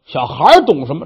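ordinary delivery

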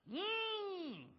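long, high appraising "mmm"; approving hum. Acted. Gang boss looking over the captured wife, humming approval. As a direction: draw out a high hum of interest. Villain sizes up the woman.

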